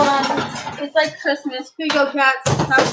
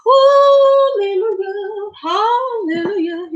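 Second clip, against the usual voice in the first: excited rejoicing; 8 semitones higher, 3 dB louder, slower than usual. Real, not acted. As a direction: sing out praise in real joy, then settle. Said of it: "I'm a little bit excited".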